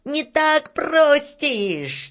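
high, drawn-out yell to stop; cross little man's high cry; angry shout. A narrator's reading. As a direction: shout him down crossly, stretching the words. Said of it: "сердито закричал Рыжий".

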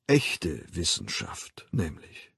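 leiser und tiefer, bis ins Schweigen; verstummend. A narrator's reading. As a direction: die Worte verklingen lassen. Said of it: "Er verstummte". (leise verklingend, tiefer gesprochen)

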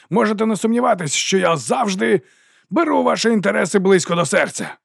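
high, assured rallying speech; ringing oration. A narrator's reading. Captain promises troops an easier fight, ringing oratory. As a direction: pledge it grandly to the crowd. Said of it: "Його голос дзвенів".

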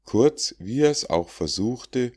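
plain reading voice

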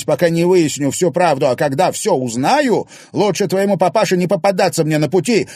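loud, high raging tirade; furious threats. Acted. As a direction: hurl threats at him. Furious phone quarrel, threats. (в ярости, громко)

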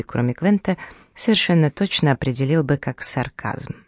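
ordinary delivery

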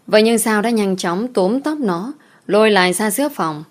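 ordinary delivery

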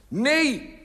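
very high, loud cry; one shrieked word, pitched far up; cried out. A narrator's reading. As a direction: wail it out in dismay. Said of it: "kreet ik".

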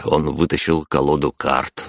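ordinary delivery, nothing marked

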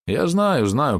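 usual speaking voice

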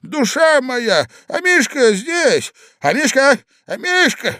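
high, agitated calling; old man shouting. A narrator's reading. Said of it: "кричал старичок". (старичок кричит, зовёт собачку)

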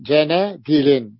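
جندل drawn out very slowly as janadilin; teacher spells out جندل syllable by syllable; slow demo pronunciation. Shown as a demonstration. Teacher drills students on the plural form, very slow.

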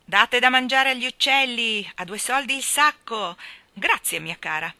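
loud, cheerful street call. Acted. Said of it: "gridò gaiamente la donnona grassa grassa".

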